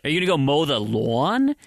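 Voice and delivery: LAWN stretched with a rounded "aw"; accentuated W. Acted. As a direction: mockingly overdo the W in "lawn". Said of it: "really accentuate the W".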